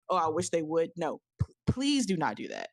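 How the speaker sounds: normal talking voice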